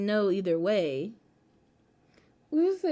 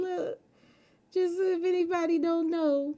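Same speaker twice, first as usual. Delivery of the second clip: ordinary talk, nothing marked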